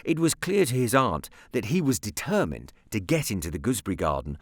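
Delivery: plain reading voice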